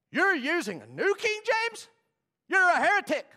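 high, very loud shouted indictment; mock outraged accusation. Old rule-keeper accusing someone over a Bible version, outraged shout. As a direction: bellow it like a scandalised rule-keeper.

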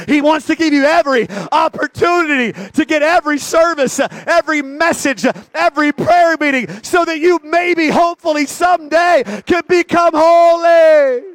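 rising from strong preaching to a shout; building preaching. Acted. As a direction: build the sermon to a holy shout.

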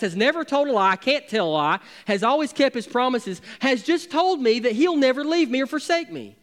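ordinary talk, nothing marked

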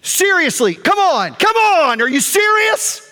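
high, rising incredulous outburst; retold exasperated ranting. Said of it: "I went on like that".

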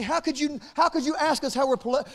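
ordinary talk

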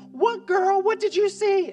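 high, loud excited cry; excited squeal. Retold or imitated. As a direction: shriek it like the best gossip ever. Thrilled girlfriend hears gossip.

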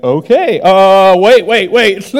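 mock confused stammer; baffled. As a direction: scramble after someone who vanished. Man baffled as Jesus vanishes.

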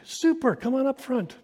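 very high, overeager cheer; squeaky-bright usher. Retold or imitated. Usher cheerfully steering the couple up front, very high. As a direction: steamroll them with cheerfulness. Usher overriding their choice.

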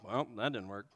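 quiet mock resignation; deflated mutter. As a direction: say it flat and defeated, as a joke. Two grown people, broken tools.

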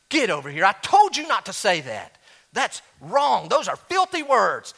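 scolding parent. Retold or imitated. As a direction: tell the kids off sharply. Parent scolding kids in story.

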